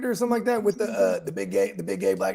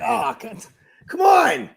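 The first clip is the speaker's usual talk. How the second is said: loud, higher exasperated "come on"; 5 semitones higher, 6 dB louder, slower than usual. Acted. Caller reacting to a bad work phone call, exasperated. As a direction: burst out in mock exasperation.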